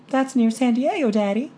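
put-on high, hesitant young woman's voice; a timid girl finally daring to hope; shy daughter. A narrator's reading. Shy daughter telling her father her dream, high and hesitant. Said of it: "she told me needlessly".

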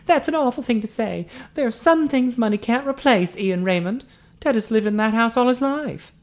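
high, indignant woman's voice; scolding Eileen. A narrator's reading. Eileen chastising Ian for talk of money, scolding. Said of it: "Eileen took him to task".

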